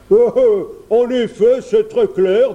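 loud, high bear character voice; blustering bear. A narrator's reading. Bear reads the map upside down, blustering. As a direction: pretend to understand, loudly, as a bear.

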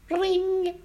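very high, ringing imitated doorbell; doorbell imitation. A narrator's reading. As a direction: trill out a high doorbell ring. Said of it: "il sonna à la porte".

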